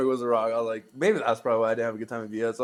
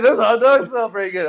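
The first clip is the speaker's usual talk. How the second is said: louder, higher quote through laughter; laughing quote; 8 semitones higher, 10 dB louder than usual. Retold or imitated. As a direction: quote your friend while cracking up. Retelling friend over laughter.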